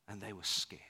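breathy whisper, barely voiced; whispered fear. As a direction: lean in and whisper it like a secret.

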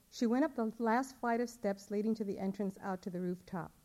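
plain reading voice

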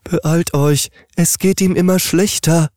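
high, pressing voice of a panicky mattress; urgent mattress voice. Acted. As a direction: hurry them along in a high voice. Frightened mattress urges haste.

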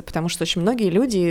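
ordinary talk, nothing marked

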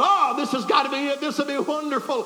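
high-pitched, delighted anticipation; excited hope. Retold or imitated. Preacher spotting the nice bungalow, high and excited. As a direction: gush it with high hopes.

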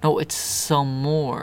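stubborn slow spelling-out; slow two-word insistence. Shown as a demonstration. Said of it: "You're not saying some more".

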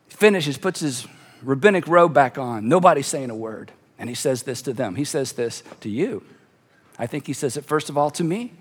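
low, soft, measured storytelling; hushed narration. A narrator's reading. Preacher telling how nobody's saying a word, hushed. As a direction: draw them into the silence.

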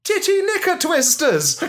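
high camp exclamation; camp outburst. Acted.

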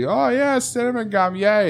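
high, chirpy mock enthusiasm; mock fan voice. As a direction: squeal it like a giddy fan. Mocking cinnamon gum fans.

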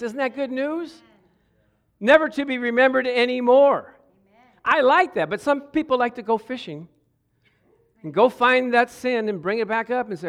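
fervent Sunday preaching. Real, not acted. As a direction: lift the room with good news. Preaching full force.

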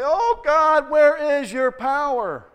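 high, loud, slow plea; crying out to God. Retold or imitated. Believers crying out to God for power, high, loud and slow. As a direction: wail it up to heaven. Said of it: "And then we say".

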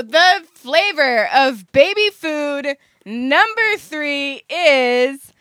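drawn-out game show announcement; slow dramatic reveal. Acted. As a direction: stretch every word for suspense.

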